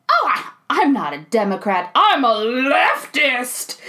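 hammed-up declaration, stretching out the key word; exaggerated. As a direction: overplay it for laughs, slowing into the punch. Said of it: "super exaggerating".

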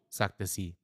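usual speaking voice

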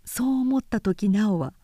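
plain reading voice

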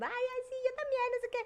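very high, mock bride-to-be voice; high-pitched parody of chattering brides; gushing brides imitation. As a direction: gush like excited fiancées. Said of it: "las novias empezaron".

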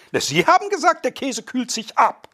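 indignant high mock quote; high indignant quoting. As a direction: throw the claim back in high indignation. Guest quotes the other's claim.

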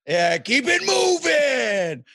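slow, loud, high dismissive shout; mock tough guy. Brushing off the pickup-truck guy, mock shout. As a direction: wave him off like a bouncer, slowly.

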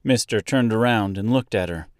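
ordinary delivery

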